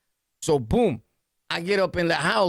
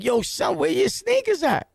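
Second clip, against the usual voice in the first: much higher, disbelieving mock outrage; shocked question; 10 semitones higher than usual. Narrator asks friend about missing sneakers, mock shocked disbelief. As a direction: act stunned he has no shoes.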